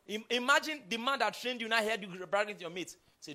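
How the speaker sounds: normal talking voice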